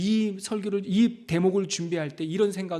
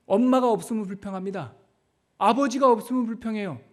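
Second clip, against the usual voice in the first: ordinary talk, nothing marked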